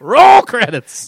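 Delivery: loud, high, very drawn-out; mock announcer call. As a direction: belt it out slowly as a mock finale. Mock film director ending the sketch.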